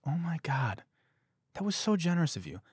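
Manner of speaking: mock admiring cashier. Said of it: "the cashier looks to me and she goes".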